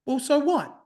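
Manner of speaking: high, breezy mock guru voice; mock influencer. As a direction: mimic a smug wellness influencer. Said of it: "influencers in, in mental mindness go".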